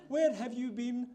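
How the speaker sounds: imitated royal accent, high in pitch; Queen accent. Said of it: "That's my Queen accent".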